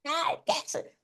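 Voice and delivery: high, loud, straining cries; mock strained shouting preacher. Shown as a demonstration. Preaching teacher mocks a preacher who shouts too hard, strained yelling. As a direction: yell like a preacher losing his voice. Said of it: "predican fuerte".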